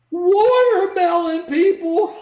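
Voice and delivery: shocked, slow disbelieving echo; incredulous. Real, not acted. Guest to Joe, echoing the slur in disbelief. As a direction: repeat it in stunned disbelief.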